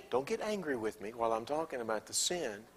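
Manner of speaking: usual speaking voice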